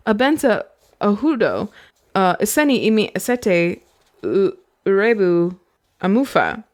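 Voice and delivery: lightly voiced heavy Kyrian accent on made-up words; a hint of thick Kyrian accent; girl in disguise speaks with a Kyrian accent. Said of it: "I spoke with a heavy Kyrian accent".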